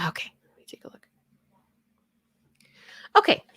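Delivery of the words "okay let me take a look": a quick whisper to herself; quick private whisper while checking the screen. Real, not acted. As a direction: mutter it under your breath, fast. Muttering to herself while reading.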